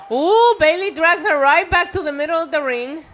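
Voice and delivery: loud, high excited call; excited commentary. Real, not acted. Live wrestling match reaction.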